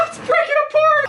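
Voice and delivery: high laughing dismay. Real, not acted.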